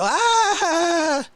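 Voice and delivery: high delighted shriek; mock gasp. Viewers watching his meltdown, with a high yelp. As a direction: let out a high thrilled yelp. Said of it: "We all watch and go".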